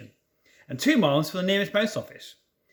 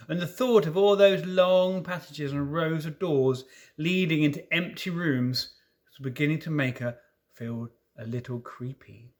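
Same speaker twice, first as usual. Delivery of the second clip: ordinary delivery, nothing marked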